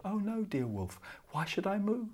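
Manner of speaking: put-on high, soft innocent girl; little girl voice. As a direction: play the sweet innocent child. Girl answers the wolf innocently.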